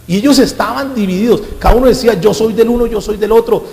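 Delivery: high, loud sermon voice; shouting preacher; fiery preaching. Acted. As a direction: preach it out full force. Preaching at full force.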